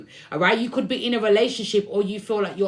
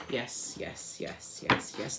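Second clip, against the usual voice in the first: whispered yeses under the breath; whispering; 9 semitones lower, 11 dB quieter, much slower than usual. Real, not acted. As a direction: quietly confirm to someone off mic. Hushed side confirmation.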